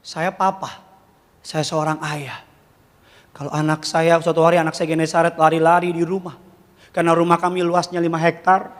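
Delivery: ordinary delivery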